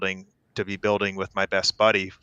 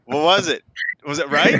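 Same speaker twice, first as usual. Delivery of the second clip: excited; 6 semitones higher, 6 dB louder than usual. Real, not acted. Eager to hear the verdict.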